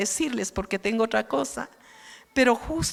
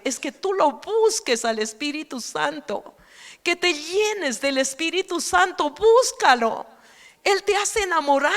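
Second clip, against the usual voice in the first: preaching; 5 semitones higher than usual. Acted. Preacher urges the congregation to seek the Holy Spirit, fervent and raised. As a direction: rise up and urge them to seek the Spirit.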